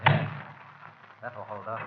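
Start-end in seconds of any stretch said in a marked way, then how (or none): 1.2-1.8 muttered aside. Acted. Wedging the cupboard door shut.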